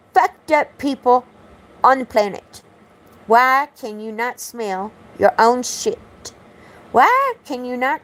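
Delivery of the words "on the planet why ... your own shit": ordinary delivery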